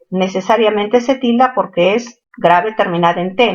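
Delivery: normal talking voice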